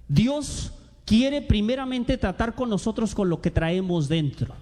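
ordinary talk

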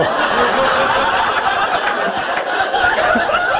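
airy, sustained laughter; loud breathy laughing fit; long breathy laugh. Real, not acted. Laughs at the story.

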